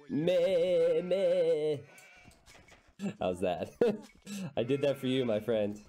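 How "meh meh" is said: high, nasal-free bleating meh; sheep bleat. Shown as a demonstration. Caster doing a sheep for a friend, bleating. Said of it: "Impersonation of a sheep".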